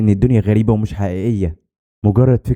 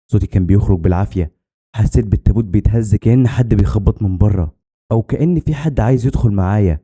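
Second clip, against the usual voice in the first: plain reading voice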